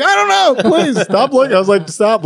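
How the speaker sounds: high, flustered retold plea; exasperated protest. Arguing with his fiancée about tics, flustered and exasperated.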